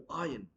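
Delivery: iron as "eye-un"; correct pronunciation. Shown as a demonstration. Teacher repeating the right form, iron as "eye-un". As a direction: leave the R silent in iron. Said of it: "R would be silent".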